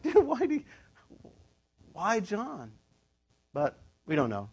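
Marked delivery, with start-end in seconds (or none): 0.0-2.7 exasperated dude. Retold or imitated. Preacher asking the angel why John, mock exasperated. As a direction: throw up your hands at the angel. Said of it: "And I'm like".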